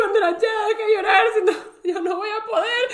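high, pleading through giggles; laughing plea. Retold or imitated. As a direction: beg her to stop while laughing. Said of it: "y le digo". (súplica entre risas, aguda)

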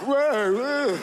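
overacted startle. Shown as a demonstration. Showing the audience how he overacts, hammed-up startle. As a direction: overact the surprise on purpose. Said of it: "See, I overact".

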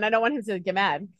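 usual speaking voice

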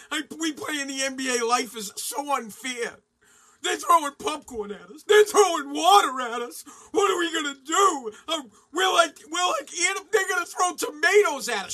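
loud, high, hammed-up crybaby wail; mock whining. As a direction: whine like a pampered player throwing a tantrum. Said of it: "He said".

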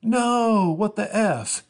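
high, loud, shrill outburst of dismay; mock dismayed fan. Comedian voicing a fan who missed his show, high and loud.

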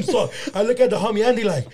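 ordinary talk, nothing marked

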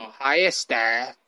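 put-on low, soft echo in Filipino accent; mock Filipino accent. Host echoing partner's mom, low mock Filipino accent.

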